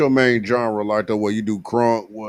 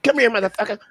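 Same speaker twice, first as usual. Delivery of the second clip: high, menacing boxer's taunt; Mike Tyson impression; 9 semitones higher, faster than usual. Retelling a fight, mocking Mike Tyson's high taunt. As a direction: call him over like an angry champ. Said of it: "That motherfucker was like".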